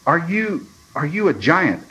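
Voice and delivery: timid question to a huge unseen thing; nervous questioning. A narrator's reading. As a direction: stammer the start, voice lifted in alarm. Frightened boy questions unseen thing.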